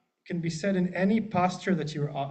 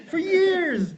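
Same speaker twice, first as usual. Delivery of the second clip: laughing high joke; 13 semitones higher, 6 dB louder than usual. Real, not acted.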